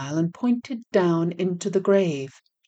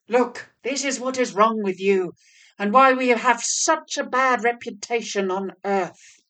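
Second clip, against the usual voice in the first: scolding devil; 5 semitones higher, 5 dB louder than usual. A narrator's reading.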